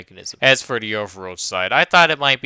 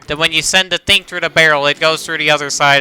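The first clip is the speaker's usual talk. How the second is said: louder, higher, pushing through; fed-up, insistent delivery; frustrated retake; 4 semitones higher, 7 dB louder than usual. Real, not acted. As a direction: force the line out after repeated flubs. Frustrated retake after several flubs.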